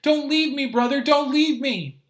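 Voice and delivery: high, loud, desperate wail; crying plea. Acted. Terrified little brother clinging outside, crying high and loud. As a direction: beg him not to go, half sobbing.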